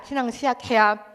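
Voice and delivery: usual speaking voice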